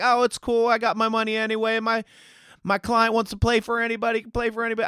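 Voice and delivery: high, hammed-up Southern agent voice; mocking Southern accent. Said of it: "I know he doesn't have a Southern accent, but I'm doing it".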